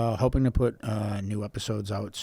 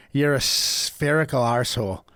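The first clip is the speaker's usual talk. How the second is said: put-on botched Scouse accent slipping into Irish; Irish-sounding Scouse attempt. Reading an insult to co-host, accidental Irish accent. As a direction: try Scouse, land in Irish. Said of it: "Why am I Irish?".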